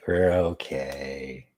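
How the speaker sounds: low, slow "we're okay"; low drawn-out reassurance. Pretend. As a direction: stretch it out in a low register. Repeats line in two registers.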